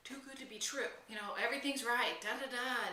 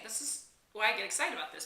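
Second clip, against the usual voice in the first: ordinary delivery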